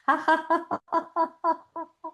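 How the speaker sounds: very high giggling ha-has; high-pitched practice laugh; exercise laughter. Shown as a demonstration. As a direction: keep a high deliberate laugh going till it fades. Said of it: "breathe and laugh and move along".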